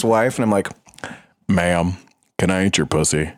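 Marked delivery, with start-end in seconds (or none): 1.5-3.3 much lower, put-on straight guy. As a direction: drop low and play it straight to shock him. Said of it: "talk to me in a straight guy voice".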